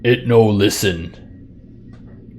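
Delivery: slow, loud dopey giant voice; dopey cartoon bellow. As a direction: talk like a dim brute giant.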